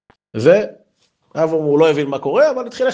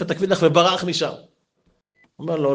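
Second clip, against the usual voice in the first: ordinary delivery, nothing marked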